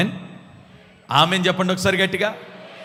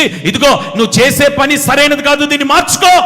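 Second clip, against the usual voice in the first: loud, higher warning; stern shout; 7 semitones higher, 8 dB louder than usual. Acted. God warning the sinner to change, in a loud stern shout. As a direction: command them to change, shouting.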